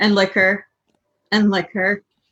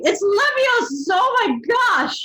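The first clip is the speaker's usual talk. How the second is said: shouting Hermione; 12 semitones higher than usual. A narrator's reading. Hermione bursting in on Ginny, shouting the right pronunciation. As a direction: correct the spell, shrill and shocked. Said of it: "burst through the door shouting".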